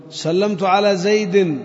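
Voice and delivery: slow "زيد" with its kasra tanween sounded; slow full reading. Shown as a demonstration. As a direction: read slowly and sound the ending of "زيد". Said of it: "contrast with pausal take". (قراءة بطيئة مع تنوين "زيد")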